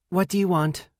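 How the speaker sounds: mildly annoyed sister on the phone; curt phone greeting. A narrator's reading. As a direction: answer the call like it's a nuisance. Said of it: "My call is so nicely answered".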